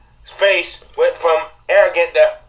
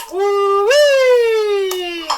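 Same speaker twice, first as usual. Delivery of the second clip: excited whoop; 14 semitones higher than usual. Real, not acted. Reacting to spanking video.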